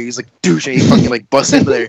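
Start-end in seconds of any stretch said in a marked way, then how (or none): 0.4-0.7 sharp vocal thud, falling off; imitated door slam. Man retelling a stall door being rammed, imitated bang. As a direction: imitate a shoulder hitting a door. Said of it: "throwing his fucking shoulder".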